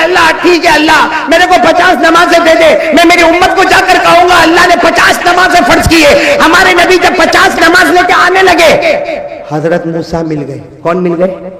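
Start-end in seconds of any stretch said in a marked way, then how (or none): 0.0-9.0 raised, ringing sermon voice; preaching at full force. Acted. Preacher retelling the Prophet's plea to a village crowd, ringing and raised.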